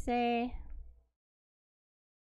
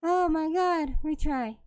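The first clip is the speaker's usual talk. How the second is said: breathless high rattled voice; shaken aftermath; 5 semitones higher, 4 dB louder than usual. Real, not acted. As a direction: still shaking from the scare. Just died to screamer.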